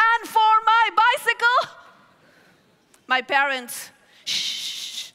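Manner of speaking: ordinary talk, nothing marked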